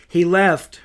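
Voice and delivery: panicked cry for help, building to the last words; frantic. A narrator's reading. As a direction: plead urgently as if lives depend on it. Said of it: "Elizabeth was frantic".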